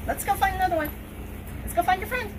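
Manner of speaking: squeaky dog talk. Real, not acted. Coaxing the dog.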